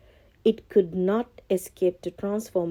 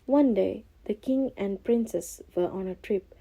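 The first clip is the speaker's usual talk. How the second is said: plain reading voice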